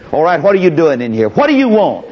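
fast, high, demanding challenge; imperious Pharaoh. Acted. Pharaoh challenging Moses in his office, high demanding voice. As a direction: snap it like a king interrupted.

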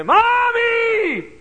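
put-on high, loud lost-child cry of Mommy. Lost boy in store calling for his mother, loud high cry. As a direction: call out for mother in panic.

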